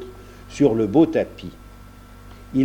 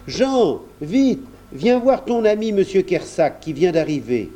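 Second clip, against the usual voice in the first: higher-pitched summons; calling out eagerly; 8 semitones higher than usual. A narrator's reading. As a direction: hurry the boy along with good news. Barcus calls Jean over.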